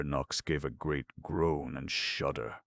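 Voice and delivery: ordinary delivery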